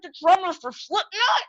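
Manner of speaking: high-pitched, excited explaining; acted-out fan urgency; urgent insistence. Son telling his dad who the drummer is, excited and urgent.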